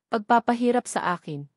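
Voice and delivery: plain reading voice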